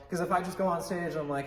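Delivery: ordinary talk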